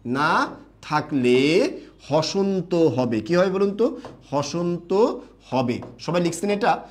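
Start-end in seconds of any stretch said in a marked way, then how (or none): 0.1-3.2 very slow, stretched word endings; slow dictation. Shown as a demonstration. Teacher repeating the rule for note-takers, very slowly. 4.3-5.8 slow, separated words; slow dictation. Shown as a demonstration. Teacher confirming the rule to students, slowly.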